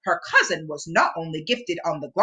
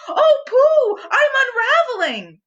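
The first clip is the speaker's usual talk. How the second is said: high, loud alarmed squeal; Piglet crying out; 5 dB louder than usual. A narrator's reading. Piglet crying to Pooh while unraveling, high and alarmed. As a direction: panic as the scarf comes apart. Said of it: "Piglet cried".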